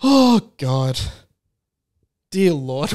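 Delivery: ordinary talk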